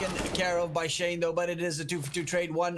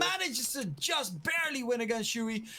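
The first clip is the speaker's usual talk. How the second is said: excited; 7 semitones higher than usual. Real, not acted. Clutch fight in live play-by-play.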